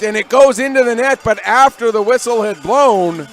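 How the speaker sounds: loud, high burst of live commentary; excited goal call. Real, not acted. Commentator calling a disallowed goal to listeners, excited. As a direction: erupt as the puck goes in. Puck goes in after whistle.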